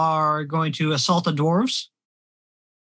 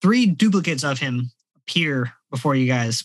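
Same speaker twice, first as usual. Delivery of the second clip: ordinary talk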